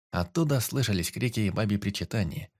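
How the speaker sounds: ordinary delivery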